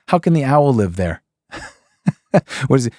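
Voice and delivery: normal talking voice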